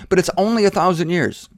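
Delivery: normal talking voice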